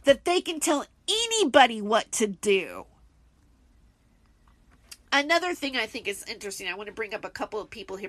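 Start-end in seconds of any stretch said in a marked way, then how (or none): 0.0-2.9 exasperated. Real, not acted. Ranting at celebrities.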